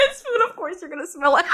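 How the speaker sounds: breaking into laughter mid-quote; laughing while quoting. Real, not acted. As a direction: quote it through real giggles.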